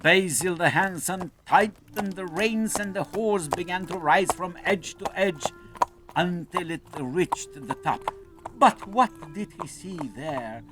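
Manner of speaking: usual speaking voice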